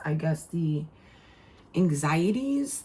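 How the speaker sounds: ordinary talk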